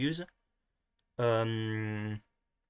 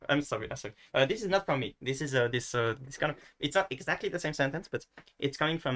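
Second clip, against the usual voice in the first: ordinary talk, nothing marked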